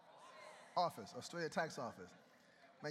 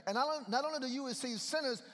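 hammed-up outraged accuser; indignant Pharisees; 4 semitones higher than usual. Preacher acting out Pharisees scolding Jesus, indignant.

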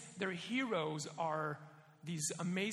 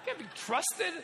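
mock outraged dad; 11 semitones higher, 9 dB louder, slower than usual. Mock outrage at baby holders.

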